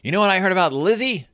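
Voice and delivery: high, quick tattling yell; hammed-up furious little sister; mock yelling. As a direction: yell it as a childish accusation. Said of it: "And she starts yelling".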